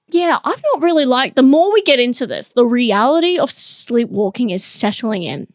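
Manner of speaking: ordinary talk, nothing marked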